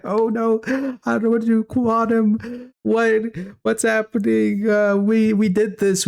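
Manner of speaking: high, frantic imitation of panicking parents; mock panic. Son imitating his high, panicking parents. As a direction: play the parents freaking out after drinking THC tea.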